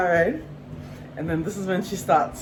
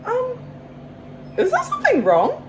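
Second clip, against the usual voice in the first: much higher, careful questioning voice; retold delicate challenge, pitched way up; pointed polite query. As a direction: ask high and politely, as if puzzled. Retold pointed question to sulking volunteer.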